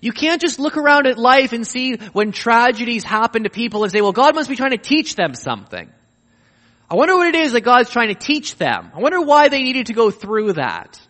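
Jesus insisting and mocking the wrong idea, loud and high; emphatic Jesus. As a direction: insist on the no, then mock the wrong thinking. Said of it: "it's emphatic".

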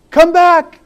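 high, loud call after her. Acted. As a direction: call out to the fleeing girl. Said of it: "called the prince".

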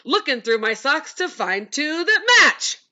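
high, slow silly character voice; random silly voice. Shown as a demonstration. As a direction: say it in any odd voice, slowly. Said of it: "you just say something in a random voice".

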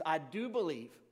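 desperate plea. A narrator's reading. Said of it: "the father instantly cried out".

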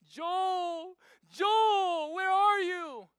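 high, very loud hammed-up wail; mock wailing cry. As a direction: play a distraught bride wailing for her groom. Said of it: "break down and cry".

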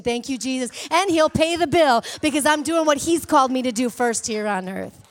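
fiery pulpit exhortation; full-force preaching. Acted. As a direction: build the congregation to a fever.

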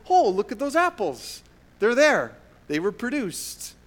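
high, loud delighted surprise; suddenly spot the apples, amazed; surprised discovery. Pretend. Noticing apples suddenly appeared.

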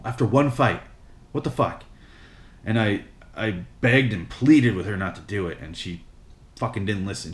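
ordinary talk

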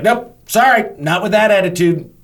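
high-pitched mock apology; dismissive refusal. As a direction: brush them off, no patience. Retold brush-off, walks away.

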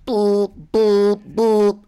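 loud, high mock alarm boop; alarm beeps. Brain as flooding submarine, loud high alarm beeps. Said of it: "it's like".

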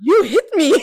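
high, precious little complaint; lightly mock a delicate victim. Mocking testimony she'd never give, a bit high. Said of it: "I wouldn't be like".

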